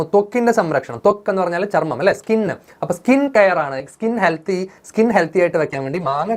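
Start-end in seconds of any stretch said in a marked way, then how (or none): none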